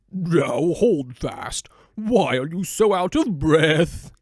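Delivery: put-on slow, drawn-out old donkey calling to a stranger; a weary old donkey, slowly stopping a stranger. Donkey hails the panting dog on the road, slow and drawn out.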